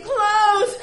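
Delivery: high, loud yelp; one shrieked word; screamed word. Retold or imitated. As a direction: scream it mid-scamper. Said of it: "Scamper and scream".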